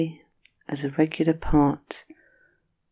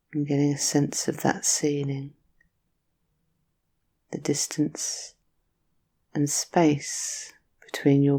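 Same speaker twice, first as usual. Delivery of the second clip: soothing Yoga Nidra guide; guided meditation. Acted.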